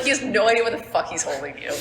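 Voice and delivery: usual speaking voice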